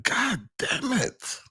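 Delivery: high, slow muttered curse; lightly voiced coach; mock coach curse. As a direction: grumble it like a frustrated coach. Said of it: "He's like".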